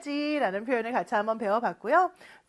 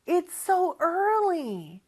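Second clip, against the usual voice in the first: slow model line; 7 semitones higher, much slower than usual. Shown as a demonstration. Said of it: "같이 한번 연습해볼까요".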